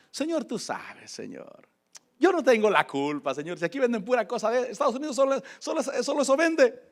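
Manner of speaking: playful excuse. Retold or imitated. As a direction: plead innocence playfully to the Lord. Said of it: "jugando con el Señor y excusándome".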